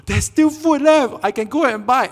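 thrilled hungry diner spotting the shop; excited. Pretend. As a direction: burst out with joy at finding food.

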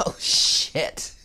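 a breathy, startled oh shit; shocked. Real, not acted. Reader turns over a card and is startled, shocked. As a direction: caught off guard by the card.

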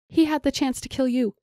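high, urgent protest; pleading outcry; crying out. A narrator's reading. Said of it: "Janir cried".

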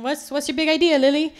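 mock a pushy boss asking for the big idea. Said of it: "I have my bosses going".

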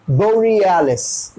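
"बोरियालिस" slow with a drawn-out middle; stretched vowel. Shown as a demonstration. Teacher explains the long vowel, "बोरियालिस" drawn out. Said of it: "टानना पड़ेगा".

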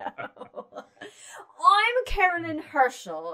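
put-on high, drawn-out aristocratic British accent; loud, slow British aristocrat; proper British. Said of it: "I'm very proper British".

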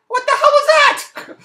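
high, slow, squealing disbelief; incredulous shriek. Real, not acted. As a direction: shriek it like you can't believe your eyes. Outraged at the friend's gesture.

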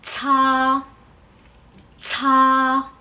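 "cha" as ts with a puff, then long a; aspirated CA. Shown as a demonstration. Teacher modelling C A, "cha" said as aspirated tsa. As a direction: hold "cha" level after an exhaled ts. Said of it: "Se pronunciaría".

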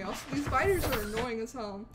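ordinary talk

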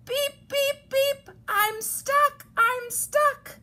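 very high, loud small-truck voice; crying little truck. A narrator's reading. Little Blue stuck in mud, high distress cry. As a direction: squeal it as a distressed little horn. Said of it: "cried the Little Blue Truck".

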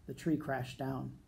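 ordinary delivery, nothing marked

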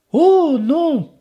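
put-on high, innocent little boy voice. Enzo feigning innocence to the ogre, high. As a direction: play the sweet innocent kid. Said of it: "avec un air innocent".

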